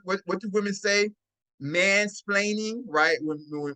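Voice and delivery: normal talking voice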